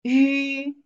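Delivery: 鱼 said as yu, rising from mid to high; rising second tone on 鱼; second tone demo. Shown as a demonstration. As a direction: Mandarin 鱼 demonstrated rising. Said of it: "Second tone".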